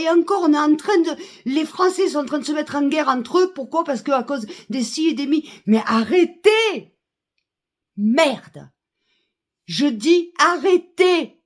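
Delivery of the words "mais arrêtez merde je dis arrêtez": exasperated demand. Real, not acted. Fed-up citizen ordering warmongers to stop, exasperated.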